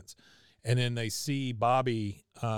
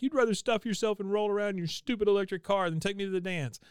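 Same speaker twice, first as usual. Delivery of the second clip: high mock-tearful girlfriend; crying Connie; 9 semitones higher than usual. Connie upset Bobby won't take her dancing, mock-tearful high voice. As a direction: pitch way up, lightly imitating tears. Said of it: "she starts crying".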